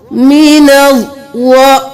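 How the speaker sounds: मिनज़ with a clear jerk on the ज़; मिनज़ slowly, stopping sharp on the ज़; jerk on the hamza. Shown as a demonstration. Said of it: "ज़ दो बार अदा हुआ". (मिनज़ में ज़ पर झटका देकर धीरे पढ़ना)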